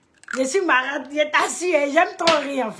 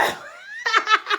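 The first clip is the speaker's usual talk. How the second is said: a sudden high squeal of laughter; helpless high-pitched giggle. Real, not acted. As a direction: squeal with real delight. Burst of laughter at chat.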